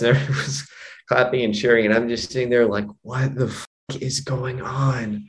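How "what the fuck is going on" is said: slow, hushed, dumbfounded reaction; quiet, stretched-out bewilderment; stunned disbelief. Retold or imitated. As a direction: draw it out softly, utterly baffled. His stunned reaction at the show.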